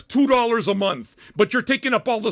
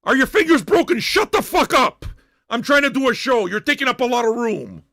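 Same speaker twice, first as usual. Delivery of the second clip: loud, higher-pitched rant; angry yelling; 4 semitones higher, 4 dB louder than usual. Real, not acted. Host yelling at cheap viewers for taking up room, angry. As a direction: yell it at a freeloader. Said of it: "why I'm yelling right now".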